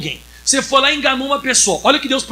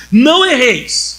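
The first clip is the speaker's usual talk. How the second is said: solemn scripture reading; 5 dB louder, much slower than usual. Acted.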